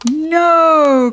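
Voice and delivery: high, loud no; cried out. A narrator's reading. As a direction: shout to stop them. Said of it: "cried George".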